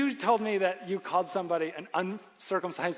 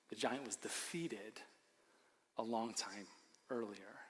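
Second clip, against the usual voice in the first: ordinary talk, nothing marked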